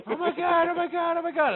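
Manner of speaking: high-pitched imitation of panic; mock freak-out. Blindfold-bound partner seeing the mouse trap, mock freak-out. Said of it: "they freak out instantly".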